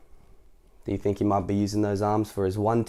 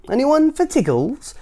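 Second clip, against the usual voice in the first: Mister Tickle; 5 dB louder, slower than usual. Acted. Mister Tickle voiced, very high.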